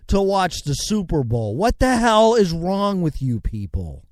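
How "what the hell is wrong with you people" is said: usual speaking voice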